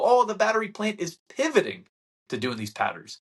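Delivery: high, sing-song "Oh, the battery plant"; mocking the media. Mocking the media's pivot story, high and sing-song. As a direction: parody the reporters. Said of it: "They go".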